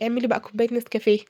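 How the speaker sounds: normal talking voice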